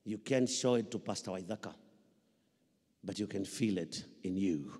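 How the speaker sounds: normal talking voice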